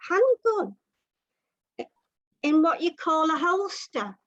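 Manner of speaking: ordinary delivery, nothing marked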